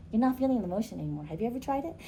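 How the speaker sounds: normal talking voice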